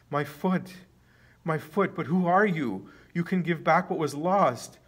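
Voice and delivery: high, loud cries of amazement; astonished shouting. A narrator's reading. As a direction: shout it out, overwhelmed by the healing. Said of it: "he shouts and shouts".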